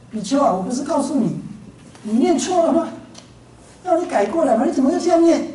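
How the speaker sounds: acted-out scolding, pitch climbing on the questions; disbelieving teacher's rebuke; exasperated reproach. 阿难再次责问比丘.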